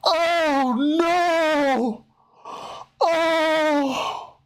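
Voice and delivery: high, slow mock pity for a sad kid. Host pities the caller, high mock pity. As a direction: coo over him like a pitiful child.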